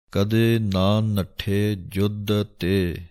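ਕਦੇ ਨਾ ਨੱਠੇ ਜੁੱਧ ਤੇ slowly, each word set apart; slow and separated. Shown as a demonstration. Teacher showing students how to separate the words, slow and deliberate. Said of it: "ਆਰਾਮ ਨਾਲ ਨਖੇੜ ਨਖੇੜ ਕੇ ਬੋਲਣਾ ਹੈ".

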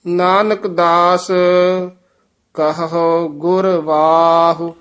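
measured Gurbani reading with drawn-out endings; sustained, chant-like Punjabi scripture reading; scripture recitation. Shown as a demonstration. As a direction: recite phrase by phrase, pausing after each.

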